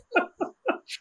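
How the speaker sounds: high delighted laugh. Real, not acted. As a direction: burst out laughing with joy. Laughing at own joy.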